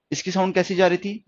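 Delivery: ordinary talk, nothing marked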